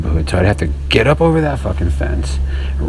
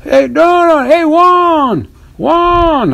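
much higher, loud stretched call; yelling for Juan; 3 dB louder, much slower than usual. Retold or imitated. Imitating a worker calling his coworker, high and loud. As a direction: call across the yard for Juan, drawn out. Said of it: "I thought it was a Mexican yelling".

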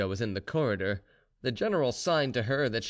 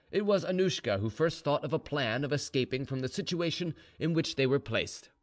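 ordinary delivery

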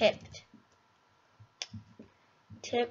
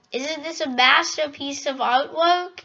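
ordinary talk, nothing marked

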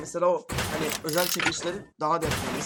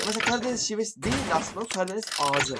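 ordinary talk

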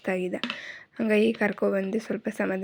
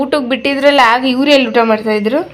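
loud questioning; 4 semitones higher, 11 dB louder than usual. Real, not acted. Mother loudly asking her son about lunch at school. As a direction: ask the little one loudly and brightly.

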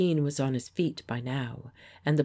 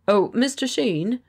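friendly hail, higher and louder; pleasant greeting; 8 semitones higher, 7 dB louder than usual. Acted. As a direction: greet him like an old friend. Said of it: "said Geoffrey pleasantly".

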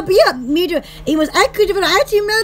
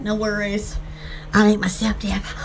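low mock voice; 9 semitones lower, 6 dB quieter than usual. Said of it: "I'll be like".

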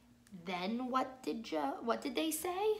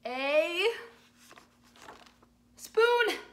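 loud high reveal; 6 semitones higher, 7 dB louder than usual. Acted. Dramatic reveal in story.

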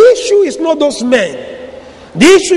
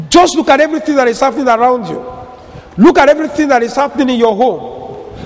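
ordinary talk, nothing marked

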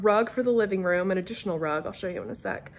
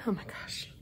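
whispering; 13 dB quieter than usual. Real, not acted. Embarrassed aside after mixing up days.

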